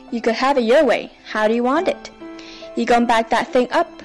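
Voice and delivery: ordinary delivery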